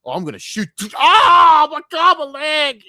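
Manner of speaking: overacted cry of a fan who shot his own leg; mock clumsy fan shooting himself. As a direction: ham up a panicked yelp of pain. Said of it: "you can imagine a Hassan fan going".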